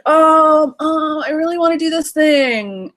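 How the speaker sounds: put-on high, pleading kid voice; whiny child. Child begging parent for permission, high whiny plea. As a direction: play the eager little kid begging. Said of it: "when the child is like".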